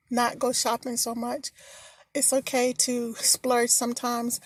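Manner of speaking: normal talking voice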